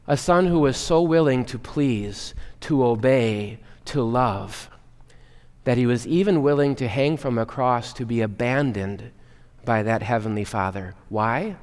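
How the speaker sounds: ordinary talk, nothing marked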